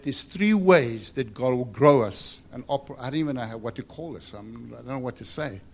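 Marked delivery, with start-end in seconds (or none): none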